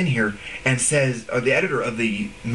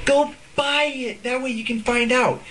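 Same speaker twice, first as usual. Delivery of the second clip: mock sales pitch; 10 semitones higher than usual. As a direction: sell it like a sleazy teaser. Mocking artists' sales gimmick.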